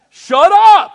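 loud, high barking shout; playful shout of shut up; mock yell. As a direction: snap it at him, hard. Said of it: "That's what he said".

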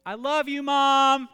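put-on high, loud little-girl call; toddler voice. Daughter calling to mom, imitated toddler squeal. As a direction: squeal it like a three-year-old.

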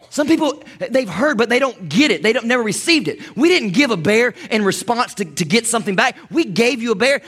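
usual speaking voice

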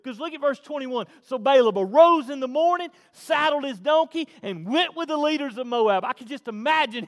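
high, loud scripture reading; full-force preaching. Acted. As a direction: drive the verse home from the pulpit.

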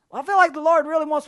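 mocking eager volunteer. Mocked eager volunteer, high.

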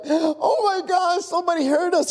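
high, strained cry of relief; acted cry of a trapped survivor; desperate relief. Trapped fireman hearing a rescuer, desperate relief.

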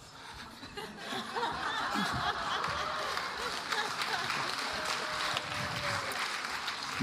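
helpless high-pitched laughter; preacher laughing at his own accent; long high laugh. Real, not acted. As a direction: laugh it out at length. Preacher cracks up at himself.

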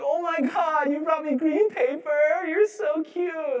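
high, slow, doting coo; God fondly cooing at a child's offering, high and slow. Pretend. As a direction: coo like a parent over a cute child. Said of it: "God thinking we're cute".

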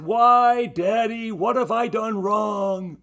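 put-on high, loud child's wail of protest; upset little boy crying out. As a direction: play the small son pleading with his dad. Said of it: "our son cried out".

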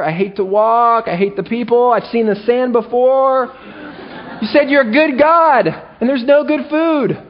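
high, petulant whining; whiny complainer. Pretend. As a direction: whine like a spoiled child on a long trip. Whining Israelites voiced in complaint.

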